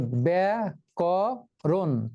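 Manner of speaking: ব্যাকরণ very slow, ব্যা-ক-রণ one beat each; teacher slowly splitting ব্যাকরণ into ব্যা, ক, রণ; syllables split. Shown as a demonstration. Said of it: "তিনটি অক্ষর".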